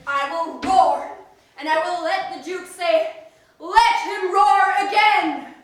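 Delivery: booming loud roar. Acted. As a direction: bellow it out proudly. Bottom roars to show off.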